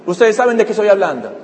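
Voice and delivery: usual speaking voice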